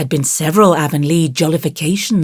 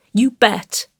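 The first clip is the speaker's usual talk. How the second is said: put-on emphatic little-boy agreement, pitched up; heartfelt, higher child's reply; 5 semitones higher than usual. A narrator's reading. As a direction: agree firmly like a small boy. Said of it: "said Davy emphatically".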